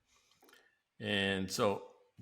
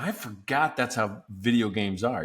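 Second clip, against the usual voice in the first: ordinary talk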